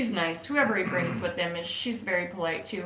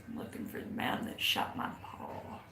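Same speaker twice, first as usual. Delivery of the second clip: punchline voice; 10 dB quieter than usual. Acted.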